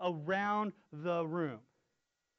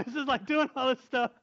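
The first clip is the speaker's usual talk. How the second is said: ordinary talk, nothing marked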